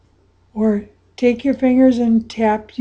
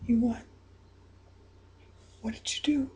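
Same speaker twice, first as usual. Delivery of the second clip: usual speaking voice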